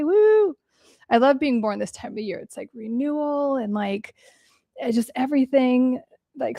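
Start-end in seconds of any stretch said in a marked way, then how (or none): 0.0-0.5 high quick whoop of joy; birthday whoop. Real, not acted. Vlogger cheering a viewer's birthday, high whoop. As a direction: cheer like you're celebrating.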